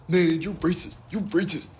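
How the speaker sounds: high, put-on voice of an offended man; mocking accusation. Online viewer accusing the comedian, mockingly indignant.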